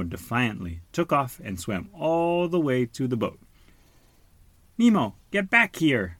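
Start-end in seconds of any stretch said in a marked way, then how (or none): 4.8-6.2 loud high shout. A narrator's reading. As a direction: yell him back. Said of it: "Marlin shouted".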